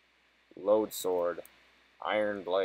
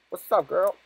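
put-on greeting voice; 10 semitones higher, 5 dB louder than usual.

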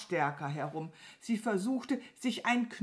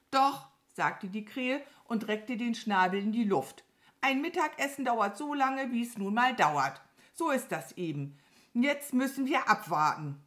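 higher, emphatic crow voice; haughty crow; 6 semitones higher, 4 dB louder than usual. Acted. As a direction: lecture firmly as the crow. (belehrende Krähenstimme)

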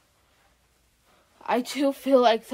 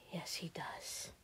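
soft whisper; 6 semitones lower, 20 dB quieter than usual. Acted. Whispered reply to princess.

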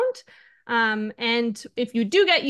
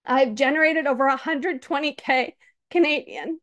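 ordinary talk

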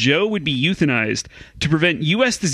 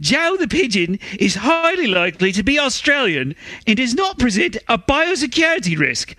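bad Australian accent pitched higher; 8 semitones higher than usual. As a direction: fake Australian accent, voice pushed up. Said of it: "just to raise the pitch of your voice".

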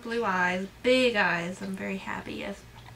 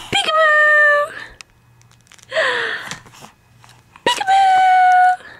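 very high, loud, slow peekaboo; peekaboo game; 9 dB louder, much slower than usual. Real, not acted.

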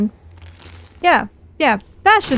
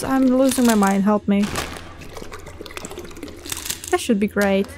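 ordinary talk

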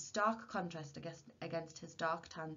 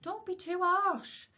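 high, loud, drawn-out protest; reproving; 13 semitones higher, 7 dB louder, slower than usual. A narrator's reading. Wife to shopkeeper husband, reproving. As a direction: chide him, stretching the words.

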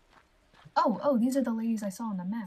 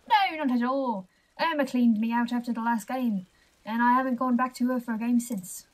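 loud guard in a put-on accent; projected, accented watchman voice; jittery guard character; 5 dB louder than usual. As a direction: play a guard making excuses, loudly.

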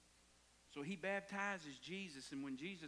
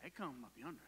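ordinary talk, nothing marked

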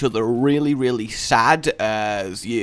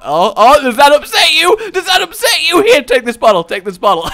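higher, louder gleeful needling; mocking taunt; 12 semitones higher, 10 dB louder than usual. Tear harvester taunting a dumped victim, loud high mockery. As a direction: jab at them with fake delight.

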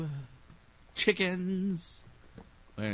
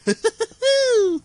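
shrieking laugh; 9 dB louder than usual. Real, not acted. Laughing fit.

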